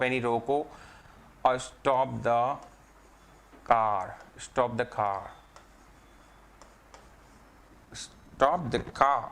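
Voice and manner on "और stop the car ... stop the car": car said slowly with the r dropped, like kaa; soft r in car. Shown as a demonstration. Teacher showing students how car is said. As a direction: stop the car, car without a rolled r. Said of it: "र का उच्चारण नहीं होता है".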